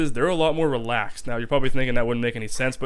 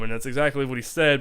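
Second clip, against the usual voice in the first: ordinary delivery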